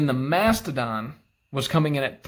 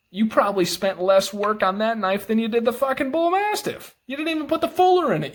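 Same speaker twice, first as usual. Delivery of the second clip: outraged rant; 8 semitones higher, 3 dB louder than usual. Real, not acted. Angry rant at knife company.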